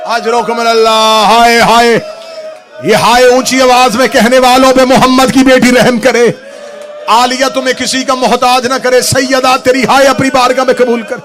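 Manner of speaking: high, loud majlis call; loud lamenting invocation. Acted. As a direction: cry out to the mourners. (ऊंची आवाज़ में मातमी दुआ)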